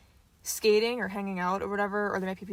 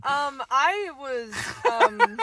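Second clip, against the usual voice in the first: laughing; 6 semitones higher, 6 dB louder, much slower than usual. Real, not acted. Laugh heard.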